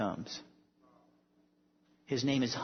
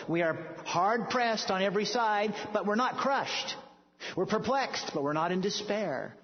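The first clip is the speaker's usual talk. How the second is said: raised, ringing scripture reading; fervent preaching; 7 semitones higher than usual. Acted. Preacher reading Corinthians to congregation, fervent and raised. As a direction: proclaim each line like victory.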